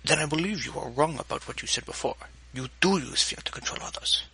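put-on tight, strained accented voice; a hint of strain under calm. A narrator's reading. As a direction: hold back the temper, words pulled taut. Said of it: "His tone was strained as he spoke".

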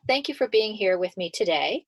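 ordinary talk

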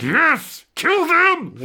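high, loud gleeful shout; gleeful villain cheer. Retold or imitated. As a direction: cheer on the slaughter with glee. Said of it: "and it's like".

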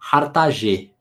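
Hartage said the French way, "ar-ta-ZHAY"; French pronunciation. Shown as a demonstration. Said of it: "Se ele é francês".

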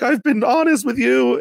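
high-pitched, wounded pleading; hammed-up betrayed appeal; mock hurt protest. Podcaster imitating a player pleading with the ally who betrayed them, mock hurt.

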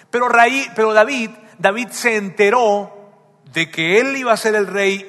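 fervent preacher building up; preaching. Acted. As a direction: drive the story home to the congregation.

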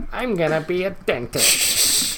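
slow, high elf voice; Christmas elf voice. Retold or imitated. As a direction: drawl it like the cartoon elf. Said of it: "elf is like".

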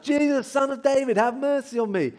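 high, insistent calling out; high-pitched pleading shout; repeated shouting. Acted. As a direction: keep crying out for mercy. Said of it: "he's shouting that".

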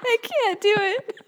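high, slow squeal through laughter; laughing. Real, not acted. Giving up on the accent attempt, laughing helplessly. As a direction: barely get it out for laughing.

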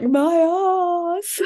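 high, drawn-out comic moan of pain; imitating someone sore lowering onto a seat; mock pained wail. Sore partner sitting down on the sofa, mock pained wail.

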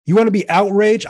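loud rising challenge to the crowd; outraged call-out. Real, not acted. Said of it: "I'm outraged".

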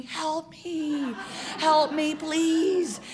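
high, drawn-out comic plea for rescue; mock silent scream. Fortune teller trapped in hugs, mock silent scream. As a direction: plead high, a hammed-up inner scream. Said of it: "I'm silently screaming".

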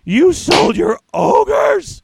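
put-on high, slow childlike gasp of disbelief; shocked little daughter. Ten-year-old daughter to dad, high and slow in disbelief. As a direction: play the ten-year-old who can't believe it.

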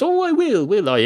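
high swooping "oh", indignant; sarcastic disbelief. Acted. Barman answering the dog's promise he'll laugh, sarcastic disbelief. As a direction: throw the promise back, pitch leaping up.

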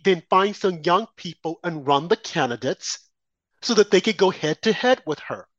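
normal talking voice

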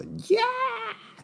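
put-on very high piercing baby shriek; mock newborn shriek, very high; baby scream imitation. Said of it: "本当に大きい声で泣きます。".

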